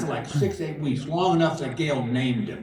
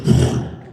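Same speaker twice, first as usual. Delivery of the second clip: loud burst of mouth noise for a bird taking off; sudden noisy whoosh of flight; imitated owl burst; 8 dB louder than usual. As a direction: blow out a loud, startled flutter. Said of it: "all of a sudden he goes".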